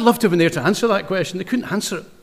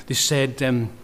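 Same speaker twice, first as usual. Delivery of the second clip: ordinary talk, nothing marked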